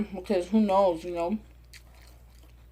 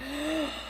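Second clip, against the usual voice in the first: gasp; 6 semitones higher, 10 dB quieter than usual. Retold or imitated. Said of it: "and I was like".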